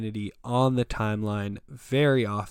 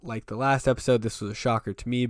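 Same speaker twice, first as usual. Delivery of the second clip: ordinary delivery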